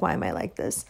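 a low self-mocking aside; muttered self-pity. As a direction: mumble it to yourself in dismay. Self-deprecating muttered quote.

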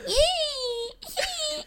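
very high-pitched imitated child's whine; mock whining. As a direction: squeal "ee, ee" like a whiny kid. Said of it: "She's, like, whining".

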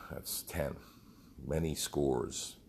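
ordinary talk, nothing marked

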